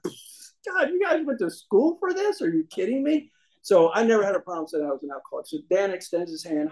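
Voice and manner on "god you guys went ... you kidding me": high mocking disbelief; incredulous. Mocking the hospital doctors, high incredulous voice. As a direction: act stunned they went to school for this. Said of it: "And I'm like".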